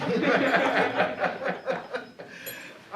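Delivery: a long, breathy real laugh; hearty laugh. Real, not acted. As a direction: laugh at your own joke. Laughs at her own punchline.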